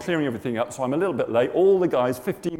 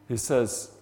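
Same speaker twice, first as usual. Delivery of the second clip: usual speaking voice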